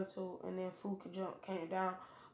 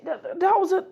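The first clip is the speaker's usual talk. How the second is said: much higher and louder stammer; flustered shout; 10 semitones higher, 11 dB louder than usual. Acted. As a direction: sputter the protest in a panic. Sasuke sputters protesting.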